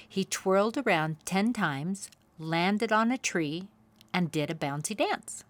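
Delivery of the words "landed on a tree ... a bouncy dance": ordinary delivery, nothing marked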